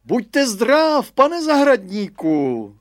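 high, loud, drawn-out hail; hearty call out. A narrator's reading. Headmaster hails the gardener from afar, calling out. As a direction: call it slowly across the fence.

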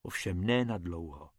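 ordinary delivery

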